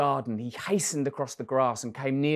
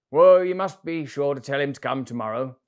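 giant's voice; 10 dB louder than usual. Acted. Giant tells children to bring boy.

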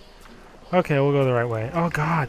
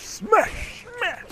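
shouting. Shown as a demonstration. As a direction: yell it at full force.

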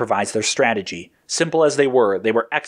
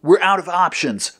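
higher, urgent declaration; firm and pressed; declaring; 7 semitones higher than usual. A narrator's reading. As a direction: declare it with finality. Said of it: "Blue declared".

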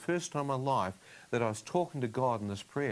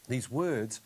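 ordinary talk, nothing marked

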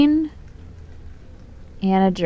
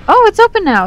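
excited squeal; 11 semitones higher, 5 dB louder, faster than usual. Real, not acted. Door finally opens.

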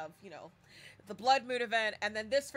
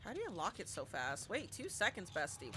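ordinary talk

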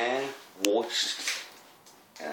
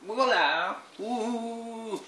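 excited; 11 semitones higher, much slower than usual. Real, not acted. Triumphant reveal.

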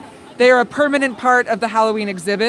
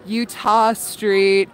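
ordinary delivery

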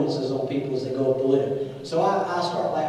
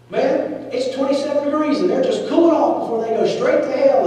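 higher, louder blurt of a ski dude; lightly voiced ski dude; 7 semitones higher, 5 dB louder than usual. Ski dude blurting to the preacher, mock outburst. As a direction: bust out like a blunt young guy. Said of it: "he lit back into him again".

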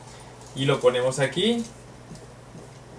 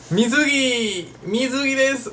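excited; 10 semitones higher than usual. Real, not acted. Teacher holding up a swimsuit for the class, delighted. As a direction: show off the swimsuit playfully. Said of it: "presenting the swimsuit".

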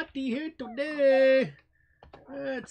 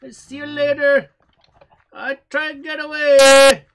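louder, rushed escape; loud fleeing shout; 3 semitones higher, 14 dB louder than usual. Real, not acted. Gamer fleeing the hunter, shouting loud. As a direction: shout it while running away.

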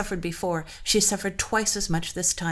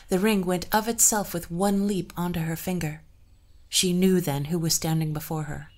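ordinary delivery, nothing marked

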